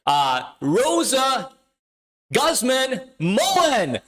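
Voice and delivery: hyped giveaway announcement. Real, not acted. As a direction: call out the winner like a game show host. Announcing giveaway winner live.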